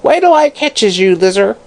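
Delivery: high shrieking crone; shrieking hag. Acted. Hag shrieking after Lizzer as she runs off, high. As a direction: screech after the fleeing girl. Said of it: "she shrieked".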